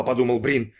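ordinary delivery

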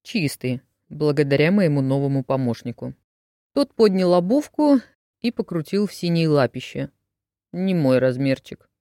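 plain reading voice

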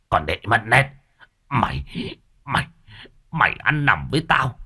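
gầm lên, giọng to dần đầy đe dọa; lão cha gầm thét chửi con gái, lên cao và to; gầm lên giận dữ. A narrator's reading. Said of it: "gầm lên sừng sộ". (gầm lên giận dữ)

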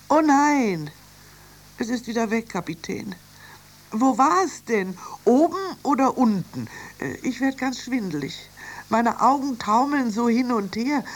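high-pitched, dismayed figure voice; flustered young sister, pitched high; Annie, flustered. Acted. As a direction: report in confusion, dizzy and upset.

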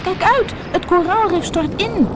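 very high, alarmed shout; high-pitched cry of danger; warning cry. A narrator's reading. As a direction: call out a panicky high warning. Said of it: "riep Devo".

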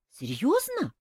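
surprised. A narrator's reading. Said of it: "удивилась Ольга".

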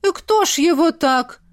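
high villager exclaiming; dismayed question from the crowd; shocked onlooker. A narrator's reading.

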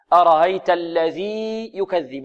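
أرأيت الذي يكذب slowly, the ي of الذي kept clear and separate; teacher's correct model reading; slow model recitation. Shown as a demonstration. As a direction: recite it slowly without merging. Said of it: "وهذه مثلها".

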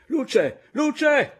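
very high, loud scream; piercing shriek. A narrator's reading. The caporale screams in the dark after a blow, piercing shriek. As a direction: shriek out in alarm. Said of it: "cacciò uno strillo acutissimo".

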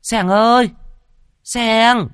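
calling out. A narrator's reading. Mistress searching the yard for her dog, calling shrilly. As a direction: call the dog across the yard. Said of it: "vừa gọi".

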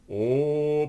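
long, loud, high "ooh"; drawn-out "oh". A narrator's reading. Friends watching the captain fill his glass, drawn-out cheer. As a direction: stretch it out like an approving crowd. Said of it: "протянули ребята".

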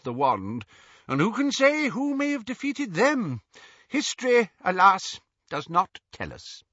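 high, airy eccentric wizard; dreamy lilting old wizard; Xenophilius voice. A narrator's reading.

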